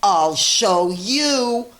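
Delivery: loud, slow defiant yell; grouchy scream. Acted. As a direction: stomp off shouting it. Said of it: "screamed the grouchy ladybug".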